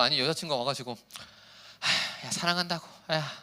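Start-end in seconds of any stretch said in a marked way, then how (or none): 1.8-3.3 dry, careless confession. Shown as a demonstration. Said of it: "이러면 겁나 빡치지".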